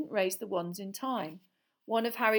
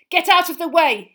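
high, very loud order; urgent shout; 10 semitones higher, 15 dB louder than usual. A narrator's reading. Harry ordering Ron and Hermione aside, shouting. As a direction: shout to clear the path. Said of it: "he shouted at Ron and Hermione".

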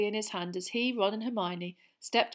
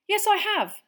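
much higher, cheerful reply; bright; 12 semitones higher, 10 dB louder than usual. A narrator's reading. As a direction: chirp it back brightly. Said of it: "said Harry brightly".

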